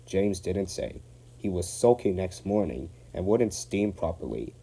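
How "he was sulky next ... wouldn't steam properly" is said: usual speaking voice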